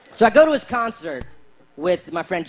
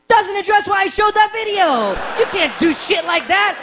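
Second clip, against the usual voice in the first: high, loud exasperated; exasperated rant; 11 semitones higher, 5 dB louder than usual. Acted. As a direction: shout it in disbelief. Comedian ranting.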